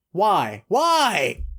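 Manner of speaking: squeaky, high-pitched why; high exasperated why. Real, not acted. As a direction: whine it up high.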